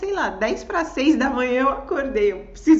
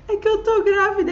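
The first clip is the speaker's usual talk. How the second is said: tearful confession; 9 semitones higher, 8 dB louder, faster than usual. Retold or imitated.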